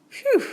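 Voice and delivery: high loud phew of relief; relieved phew. A narrator's reading. Bear relieved no mouse, high phew. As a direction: blow out the relief.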